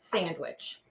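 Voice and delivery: normal talking voice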